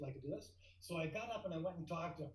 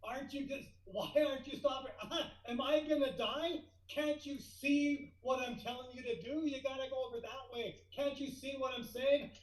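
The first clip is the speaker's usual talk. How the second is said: panicked; 7 semitones higher than usual. Retold or imitated. Marshal panics at the speeding jet, high and frantic. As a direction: panic as the jet keeps coming. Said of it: "I had a bit of panic".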